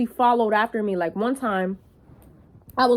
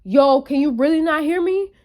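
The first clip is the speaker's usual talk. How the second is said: loud, higher calling out after her; imitating a pushy catcaller, louder and higher; 6 semitones higher, 4 dB louder than usual. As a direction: yell after her down the street. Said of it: "he's like".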